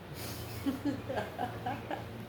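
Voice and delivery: quiet, high giggle; soft tickled laugh at your own joke. Real, not acted.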